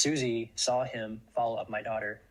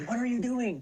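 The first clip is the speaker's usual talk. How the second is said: retold scream; 12 semitones higher than usual. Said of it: "I started doing was screaming".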